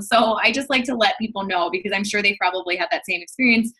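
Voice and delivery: normal talking voice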